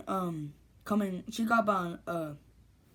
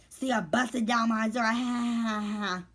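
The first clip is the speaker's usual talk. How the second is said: imitated boasting; 3 semitones higher, slower than usual. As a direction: boast trailing into a fake laugh. Imitates girl boasting and laughing.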